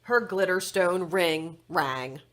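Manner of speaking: usual speaking voice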